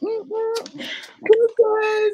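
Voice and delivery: high falsetto mm-hmm, good good; fake fine reply. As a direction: squeak it out, pretending all is well. Bruised woman answering first lady.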